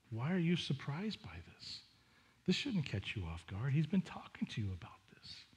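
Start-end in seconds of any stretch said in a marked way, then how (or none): 3.7-5.4 whisper. Real, not acted. Pastor gently chiding congregation.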